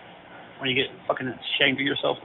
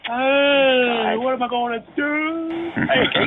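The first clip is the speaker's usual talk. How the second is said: higher and louder, jeering; mocking taunt; 10 semitones higher, 4 dB louder, slower than usual. Real, not acted. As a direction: call out to mock him. Mocking the caller, laughing.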